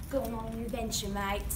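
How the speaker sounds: bad Australian accent, quick and quiet; lightly mock Australian; crappy Australian accent. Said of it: "It's my crappy Australian accent".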